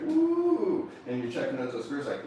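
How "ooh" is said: high, admiring ooh. Acted. Buyer eyeing a car's curves, high delighted ooh. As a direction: gasp in delight at her curves. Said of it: "you're like".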